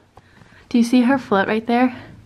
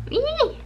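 high, short excited squeal. Real, not acted. Spotting the drink she wants, a high squeal. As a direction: squeal with delight.

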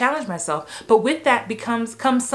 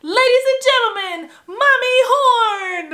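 very high, loud emcee shout; toddler announcer; 5 dB louder than usual. Retold or imitated. Toddler introducing mom, imitated high announcer shout. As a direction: belt out the introduction, stretching the name. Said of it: "as a toddler, you guys".

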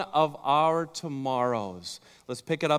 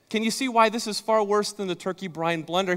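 ordinary talk

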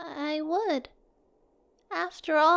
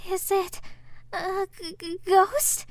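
stammering fear; 3 semitones higher, slower than usual. Acted. Meeting a ghost.

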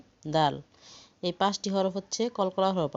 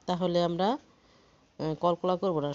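ordinary delivery

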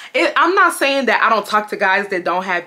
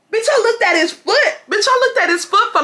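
excited; 11 semitones higher than usual. Real, not acted. Excited retelling.

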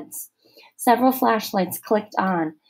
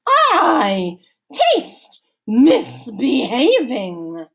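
slow, loud, higher stern matron; stern camp counselor; 5 semitones higher, 7 dB louder, much slower than usual. A narrator's reading. Missus Periwinkle interrogating Allison, stern, slow and loud. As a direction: lecture slowly like a suspicious matron.

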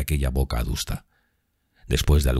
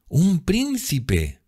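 very high, drawn-out rapturous cry; musical exclamation; much slower than usual. A narrator's reading. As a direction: sing out the words slowly in delight, without shouting. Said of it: "su voz llena de música". (exclamación musical, aguda y embelesada)

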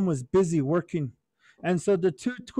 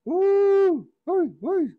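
much higher, loud whooping war cry; 12 semitones higher, 5 dB louder, much slower than usual. Acted. Little brother charging the giant in armor, loud high war whoops. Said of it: "the little brother started yelling".

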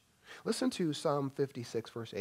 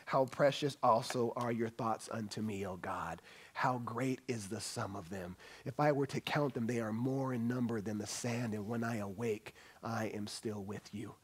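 softer, hushed and reverent, a whispered O God; soft scripture reading; 4 semitones lower, 4 dB quieter than usual. Acted. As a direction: read it like a quiet prayer. Reads verses softly.

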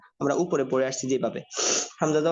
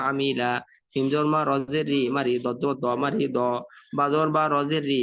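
ordinary delivery

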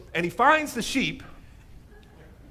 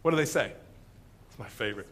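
normal talking voice

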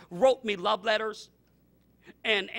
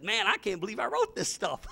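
laughing at himself; 4 dB quieter than usual. Retold or imitated. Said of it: "and I said".

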